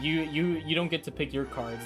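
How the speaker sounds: ordinary talk, nothing marked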